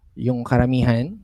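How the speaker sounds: ordinary talk, nothing marked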